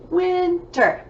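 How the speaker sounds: "Winter" in two beats, first one high; syllable by syllable. Shown as a demonstration. Teacher counting syllables for pupils, "Winter" split in two. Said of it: "two syllables".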